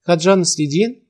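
ordinary delivery, nothing marked

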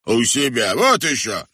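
loud, high dismissive retort; scornful exclamation. A narrator's reading. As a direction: scoff at the very idea. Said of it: "воскликнул Кривой Глаз".

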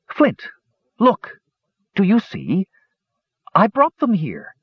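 put-on high, eager young kender voice; excited kender. A narrator's reading. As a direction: call out to Flint in wonder, high and bright.

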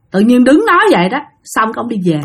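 plain reading voice

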